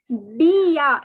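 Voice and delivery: bien as bee-a, nasal vowel, silent N; French bien. Shown as a demonstration. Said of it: "It is bien".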